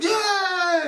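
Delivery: high, drawn-out imitated cheer; mock crowd cheer. The whole crowd answering the singer, mock cheer. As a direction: imitate a whole audience roaring, at modest volume. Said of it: "the whole crowd".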